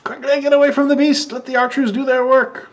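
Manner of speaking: very high little-creature yell; yelling to goblins. Acted. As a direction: urge them away in a squeaky shout. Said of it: "yells back to the goblins".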